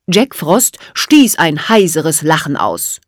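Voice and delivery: plain reading voice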